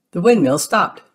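high, bright cheer. Acted. Mickey cheers when the windmill stops. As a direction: celebrate the stopped windmill. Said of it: "Mickey cheers".

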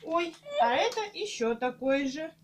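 ordinary delivery

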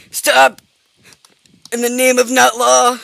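mock command. Gamer chasing fleeing prey, mock commanding shout. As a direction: holler it like a mock arrest. Calling after fleeing animal.

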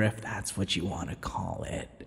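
ordinary talk, nothing marked

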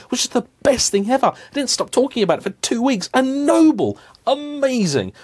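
put-on high, gushing teenage excitement; excited. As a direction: gush like a starstruck kid. Reliving teenage car excitement.

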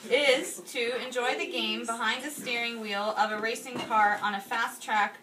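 usual speaking voice

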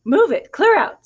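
bossy duck. A narrator's reading. Duck orders the pigs away from the hole, loud and bossy. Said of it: "declared the duck".

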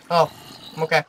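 ordinary talk, nothing marked

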